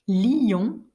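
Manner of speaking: LION split in two syllables, li-yon; diérèse. Shown as a demonstration. Teacher showing students a two-syllable lion, split diérèse. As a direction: stretch LION into li-on, two beats. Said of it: "prononcées en deux syllabes".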